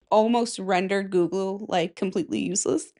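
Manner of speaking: ordinary talk, nothing marked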